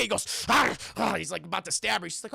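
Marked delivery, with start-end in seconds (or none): 0.5-1.1 loud imitated panic cries; mock panicked gasps. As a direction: gasp and cry out as if startled awake. Logan freaks out waking up.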